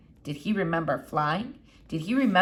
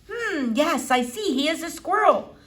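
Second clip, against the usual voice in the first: lightly voiced elderly foreign lady, higher; a hint of an old eccentric doctor; Doctor Measham; 9 semitones higher, 3 dB louder than usual. A narrator's reading. Old doctor peering at the squirrel, lightly foreign.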